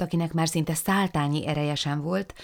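ordinary delivery

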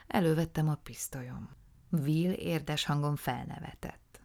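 ordinary delivery

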